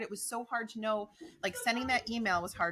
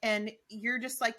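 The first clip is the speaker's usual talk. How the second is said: ordinary talk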